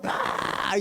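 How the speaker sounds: breathless unvoiced "Ah" mocking a shouting preacher; mock hoarse gasp. Preacher mocks hoarse pastors with a gasp. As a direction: rasp out a spent shout. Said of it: "pastores que gritan".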